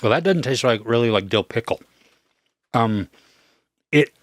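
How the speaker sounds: talking while crunching chips. Real, not acted. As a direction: mouth full of chips. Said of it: "apologize for the crunchy sound here".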